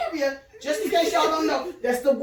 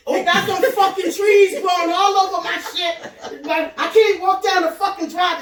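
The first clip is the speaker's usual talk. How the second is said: ordinary talk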